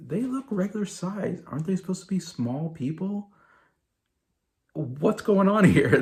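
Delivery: baffled viewer asking where the small people went. Retold or imitated. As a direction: play it as confused disbelief while watching. Puzzled thought while watching.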